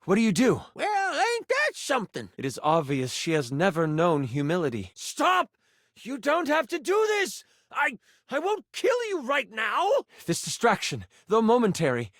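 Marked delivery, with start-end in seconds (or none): 0.7-2.3 high-pitched, put-on folksy voice; folksy character voice. Game master voices a character reacting to the fight, high and folksy. 4.9-9.9 high, pleading protest; panicked villain. Acted. Villain being pulled into the lamp begs the hero, panicked.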